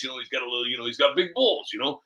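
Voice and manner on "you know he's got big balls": ordinary delivery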